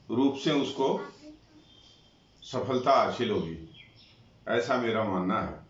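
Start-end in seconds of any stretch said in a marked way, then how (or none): none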